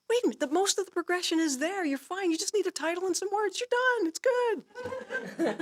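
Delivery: high incredulous stand-up voice building up; Jerry Seinfeld impression. Songwriter retells morning self-talk as Jerry Seinfeld voice. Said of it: "I sound like Jerry Seinfeld".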